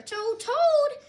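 high, loud call to wake someone; excited wake-up shout. A narrator's reading. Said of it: "shouted Frog".

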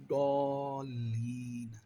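slow Quranic chant; drawn-out recitation. Shown as a demonstration. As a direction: stretch the word as in recitation. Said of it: "এটারে দাল পড়া যাবে না". (ধীর তিলাওয়াতের সুর)

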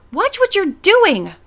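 put-on girl yelling at a clumsy boy; angry yell. A narrator's reading. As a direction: snap at him for the jab. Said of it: "Jessica yelled at him".

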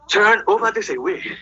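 slow, put-on hero voice; a hint of a superhero in bed. Retelling bedroom role play with his wife, lightly voiced superhero.

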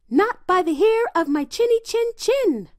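high little pig voice. A narrator's reading. Little pig refusing the wolf, high pig voice. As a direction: squeak it back, defiant and high. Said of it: "said the little pig".

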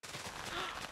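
sharp gasp. Real, not acted. Sudden idea, sharp gasp.

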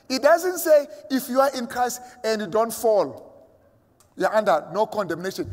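fervent sermon climax; rising preaching. Acted. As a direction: press the point harder to the congregation. Preacher quoting text forcefully.

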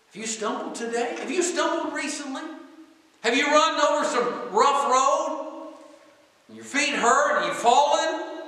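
high-pitched, intense preaching questions. Real, not acted. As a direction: press the congregation in a high, ringing preacher voice.

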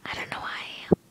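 whisper, keep it hushed. Real, not acted. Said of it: "that's why I have to whisper".

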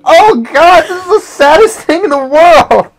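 high, loud wailing shout; hammed-up cry of grief; mock anguish. Player mourning the game fox, mock anguish.

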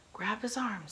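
captain shouting to a sailor to seize the prisoner; shouted order. A narrator's reading. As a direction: yell it at the sailor.

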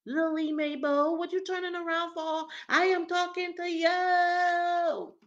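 high, loud, drawn-out holler; indignant high-pitched yell; angry confrontation. Acted. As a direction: call her out at full volume, stretching the last word. Acted angry confrontation by the woman.

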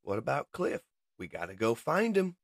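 high, small voice; small voice piping up. Acted. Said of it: "As a small voice pipes up".

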